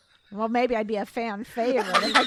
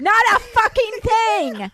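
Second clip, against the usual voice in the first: higher, louder, slowed for the punchline; punchy louder punchline; 8 semitones higher, 7 dB louder, slower than usual. Real, not acted. Wife joking to friends that her house has no lights, loud and emphatic. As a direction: land the joke with a loud slow kicker.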